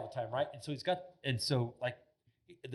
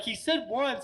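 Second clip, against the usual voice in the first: high-pitched mock indignation; high, indignant retort, retold; retold pissed-off; 10 semitones higher, slower than usual. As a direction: imitate an annoyed hunter's protest. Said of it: "so he's all pissed off".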